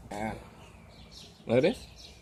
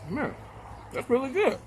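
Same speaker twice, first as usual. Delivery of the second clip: mouth full; 10 semitones higher, 4 dB quieter than usual. Real, not acted. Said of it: "I don't like to talk with my mouth full".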